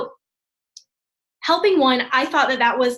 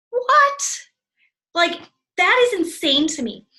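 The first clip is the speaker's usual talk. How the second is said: sudden high, loud "What?"; shocked disbelief; 6 semitones higher, 3 dB louder, slower than usual. Real, not acted. Reacting to a shocking coaching stat, stunned. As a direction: let the number floor you.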